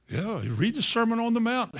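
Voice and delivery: ordinary talk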